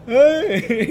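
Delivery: ay agudo y fuerte entre risas; susto con risa. Retold or imitated. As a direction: suelta un ay asustado medio riendo. Susto en la curva. (un ay asustado entre risas)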